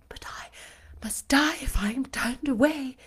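breathless whispered plea; faint whisper. Acted. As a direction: barely voice the words, spent. Exhausted beggar at the door.

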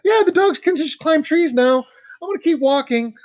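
squeaky-high, loud panicked self-talk; mock nervous denial. Hiker telling himself the eyes are dogs, high mock denial. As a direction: reassure yourself in a high, loud, put-on voice.

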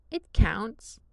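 loud, stubborn insistence. Acted. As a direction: dig in and refuse to give ground. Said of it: "Liam says stubbornly".

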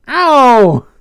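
high, loud acted "ow"; pained yelp. A narrator's reading. Vinny gets hit by Zoe, pained yelp. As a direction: yelp as if just smacked.

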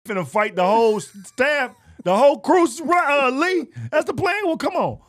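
high, loud fired-up rally; hammed-up battle cry. Acted resolve to fight staff.

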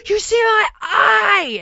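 high, loud drawn-out wail; slow, high-pitched drunken scream; wasted screaming. Retold or imitated. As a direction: wail it like a drunk teenager in agony. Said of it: "He's just wasted and screaming".

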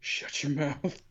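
soft, slow menacing threat. Retold or imitated. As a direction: lean in and threaten quietly. Retold threat to a crying girl.

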